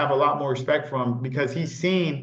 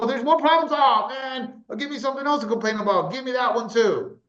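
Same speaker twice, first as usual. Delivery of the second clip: much higher, whiny mock moaning; mock complainer; 9 semitones higher than usual. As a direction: ham up someone hungry for more problems. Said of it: "They're like".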